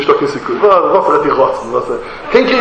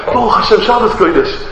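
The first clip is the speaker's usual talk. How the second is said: higher, delighted exclamations, imitated; acted joy; 6 semitones higher than usual. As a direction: rejoice as if Shabbos outweighs the lost job. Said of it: "מיט די שמחה זיינע".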